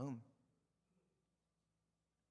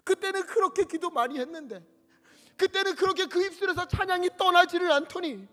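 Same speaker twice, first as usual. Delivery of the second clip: God grieving; 10 semitones higher, 10 dB louder than usual. Acted. God grieving over the unfaithful, building to a loud lament. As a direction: let God's grief build until he cries out. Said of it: "하나님이 우리를 보시면서".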